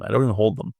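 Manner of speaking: ordinary talk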